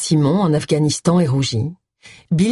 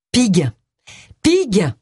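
sudden cry of recognition; eager outburst of a half-remembered word; exclaiming; 5 semitones higher than usual. A narrator's reading. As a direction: blurt it out as the word comes to her. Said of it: "s'écria Agatha".

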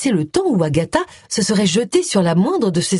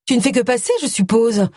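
higher-pitched, hopeful supposition; 6 semitones higher than usual. A narrator's reading. Agatha hopes her ex will move on, hopeful. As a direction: angle hopefully for a yes. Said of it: "ajouta-t-elle pleine d'espoir".

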